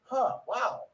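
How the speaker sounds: high, musing little wow; put-on wonderment; mock impressed. Acts his own impressed reaction.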